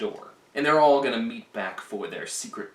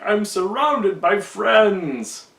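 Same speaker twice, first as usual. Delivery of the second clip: slow, louder, higher smug proclamation; smug Caesar; 6 semitones higher, 5 dB louder, much slower than usual. Retold or imitated. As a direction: savor it with lordly self-satisfaction. Pompous Caesar among conspirators.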